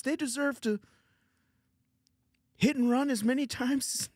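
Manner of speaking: high-pitched, strained Canadian Kermit voice; Jordan Peterson. Acted. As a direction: argue the case like a Canadian Kermit. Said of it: "you want me to do some more Peterson".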